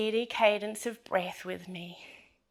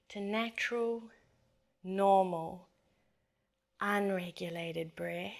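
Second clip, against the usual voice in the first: soft, fading meditation voice; guided meditation; 7 dB quieter than usual. Acted. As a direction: ease them down into stillness. Guides back to natural breath.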